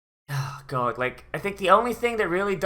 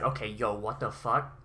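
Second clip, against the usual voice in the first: imitating Kun, lower and much softer in disbelief; stunned low mutter; 7 semitones lower, 10 dB quieter than usual. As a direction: say it low and quiet, like the penny just dropped. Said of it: "He's like".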